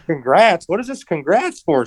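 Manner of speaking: imitated bewildered query; puzzled questioning. Retelling partner's puzzled question.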